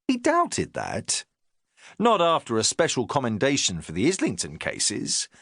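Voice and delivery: ordinary delivery, nothing marked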